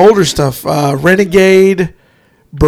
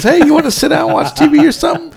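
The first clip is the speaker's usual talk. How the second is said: fast, higher mellow stoner voice; laid-back stoner; 8 semitones higher, faster than usual. Retold or imitated. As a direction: rattle it off like a friendly stoner. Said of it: "like Brad Pitt does".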